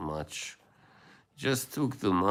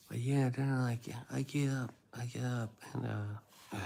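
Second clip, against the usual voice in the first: soft, higher-pitched boxer impression; Mike Tyson; 5 semitones higher, 5 dB quieter than usual. Fan mimicking the boxer recalling the Buster Douglas fight, soft high impression. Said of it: "I like listening to Mike".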